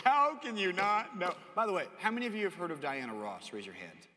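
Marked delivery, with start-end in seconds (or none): 0.0-1.4 slow, loud mock disbelief; shocked. Pastor [name] learns he doesn't know [name], shocked. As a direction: stretch it out, higher and incredulous. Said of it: "He was just shocked".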